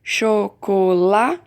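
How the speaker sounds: put-on teacher sounding out chocolá for children; slow syllable split. Shown as a demonstration. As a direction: stretch chocolá to show where the word breaks. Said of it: "E por último".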